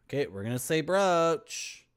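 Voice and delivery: ordinary talk, nothing marked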